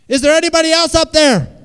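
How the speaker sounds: very high, full-volume yell upward; desperate calling out. Acted. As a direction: holler for someone far above you. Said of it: "the man said".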